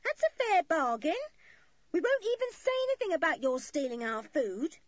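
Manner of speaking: high, loud woman bargaining; calling out. A narrator's reading. Maggie bargains with children from the boat, calling. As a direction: call across water, firm and insistent. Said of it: "called to them".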